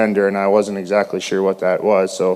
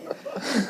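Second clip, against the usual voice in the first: soft embarrassed laugh; 13 semitones higher, 14 dB quieter than usual. Real, not acted. Shy speaker laughs after pastor's tease.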